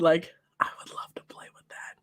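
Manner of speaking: fast, breathless whisper of longing. Retold or imitated. Girl whispering her wish about her brother's toy.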